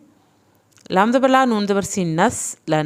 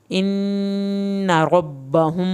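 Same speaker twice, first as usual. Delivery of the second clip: very slow, drawing out the meem before the ba; held meem sakin; much slower than usual. Shown as a demonstration. Teacher deliberately delaying to show students, very slow drawn recitation. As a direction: linger on ربہم one alif long. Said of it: "یہاں میں دیر اسی لیے لگا رہی ہوں".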